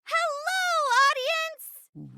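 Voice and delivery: high, sing-out stage hello; showy greeting. Acted. Performer greeting today's audience, showy.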